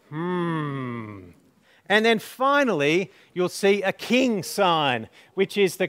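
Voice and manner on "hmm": low soft pondering hmm; thoughtful hmm. Shown as a demonstration. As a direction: hum like a wise man pondering. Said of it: "One, two, three".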